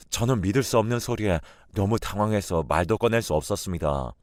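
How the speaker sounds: usual speaking voice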